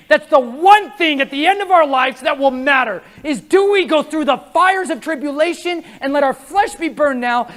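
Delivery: ordinary delivery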